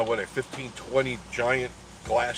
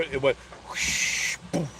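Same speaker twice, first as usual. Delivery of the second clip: imitated whoosh; 3 semitones higher, slower than usual. Imitating the fall sound.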